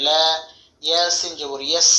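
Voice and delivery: usual speaking voice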